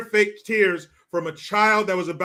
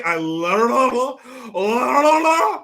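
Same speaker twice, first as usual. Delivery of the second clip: rising into a much higher, louder mock wail; mock sobbing wail; 6 semitones higher, 7 dB louder than usual. Streamer mocking an actress's fake sob, rising to a loud wail.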